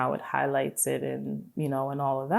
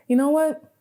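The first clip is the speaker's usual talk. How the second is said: sudden decision; 13 semitones higher, 6 dB louder than usual. Retold or imitated.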